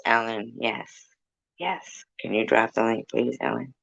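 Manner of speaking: ordinary talk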